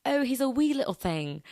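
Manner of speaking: Scottish accent. Shown as a demonstration. Podcaster showing how wee must be said, lilting Scottish accent. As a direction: put on a cute amateur Scottish accent. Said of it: "No, you have to be like".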